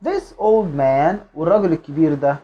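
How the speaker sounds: normal talking voice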